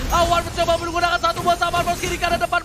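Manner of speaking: esports caster. Real, not acted. Live play-by-play builds.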